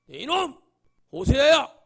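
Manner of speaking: high, loud scolding shout; thundering rebuke. Acted. God calling out Hosea to scold him, angry shout. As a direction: bellow the name as an angry God. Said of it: "하나님 호세아를 꾸짖죠".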